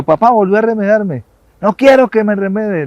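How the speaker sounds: put-on a boy's annoyed yell; shouting. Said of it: "le gritó al eco".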